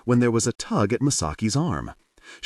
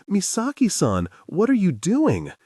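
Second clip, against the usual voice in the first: high, startled exclamation; woman scandalized by a playful housewife; crying out; 10 semitones higher than usual. A narrator's reading. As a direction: cry out half shocked. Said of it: "Hiyori cried".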